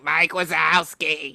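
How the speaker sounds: slow drawn-out monster-secretary impression; imitated cartoon character voice; Roz from Monsters, Inc. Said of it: "I just kept hearing".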